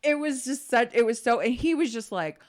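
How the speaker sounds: ordinary delivery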